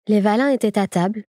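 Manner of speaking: usual speaking voice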